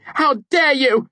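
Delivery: high, loud, slow furious shout. A narrator's reading. Devi shouting at the narrator, enraged. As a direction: hurl it with full rage. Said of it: "she shouted".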